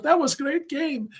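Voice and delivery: high, slow put-on foreign accent; dubious Irish accent. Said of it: "I think it might've been Irish".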